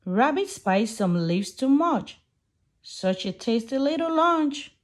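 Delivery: plain reading voice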